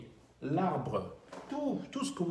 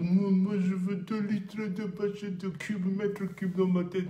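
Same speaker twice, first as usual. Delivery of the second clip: loud, slow, desperate plea; pleading voice; 7 semitones higher, 5 dB louder than usual. Pretend. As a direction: beg for air like you're suffocating. Imagined customer buying air.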